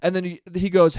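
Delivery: normal talking voice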